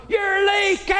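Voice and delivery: high shrill-free yell of "You're leaking!"; wife hollering. Retold or imitated. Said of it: "then she'll holler".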